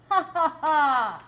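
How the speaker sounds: a greedy giant laughing at his gold, slow and loud; roaring giant laugh. A narrator's reading. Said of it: "he roared".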